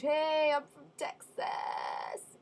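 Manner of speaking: a slow, hammed-up Texas accent. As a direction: lay on a thick Texas drawl. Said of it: "and he has an accent".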